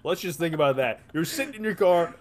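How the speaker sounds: ordinary delivery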